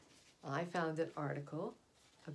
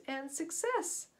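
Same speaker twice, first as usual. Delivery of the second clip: big high burst on success; excited reveal; 11 semitones higher, 4 dB louder, slower than usual. Acted. Storyteller to young listeners, a bright triumphant reveal.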